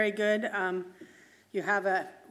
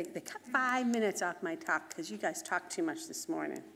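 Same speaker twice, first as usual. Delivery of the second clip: ordinary delivery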